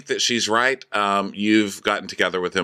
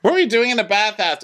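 incredulous; 11 semitones higher, 3 dB louder, faster than usual. Retold or imitated. Incredulous quoted question.

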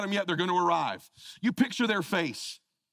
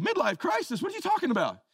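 pitched-up, incredulous mock denial; mock surprise; 9 semitones higher than usual. Father to daughter who teased him, mock surprised. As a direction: play dumb, fake-shocked. Dad answers daughter's teasing.

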